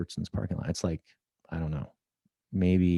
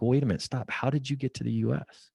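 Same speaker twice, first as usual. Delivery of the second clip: higher, urgent "wait a minute, stop"; incredulous; 7 semitones higher, faster than usual. Retold or imitated. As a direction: cut in, needing to know. Stopping her to ask.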